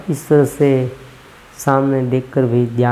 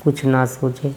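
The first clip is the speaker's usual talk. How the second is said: guided meditation. Acted. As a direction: ease the listeners into stillness, unhurried. Leads a breathing meditation.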